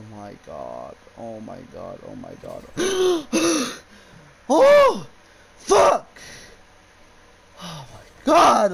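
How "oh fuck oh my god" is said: high, loud gasp then shrieked curses; gasp and scream. Real, not acted. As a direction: shriek at the jump scare. Jump scare in horror game.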